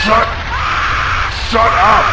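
high, loud yell to shut up; angry shout. Acted. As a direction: blow up at the noise. Satan yells at noisy demons.

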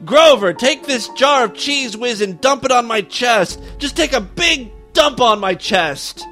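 high, eager holler. A narrator's reading. Charlie ordering Grover to douse him, hollering. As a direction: holler the order, stretching it out at the end. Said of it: "and hollered".

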